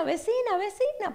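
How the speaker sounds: llamada aguda y fuerte, más alta; llamando a la vecina. Retold or imitated. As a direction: grita su nombre por encima de los perros. Calling out over the dogs. (la hermana llamando a la vecina desde la calle, a gritos)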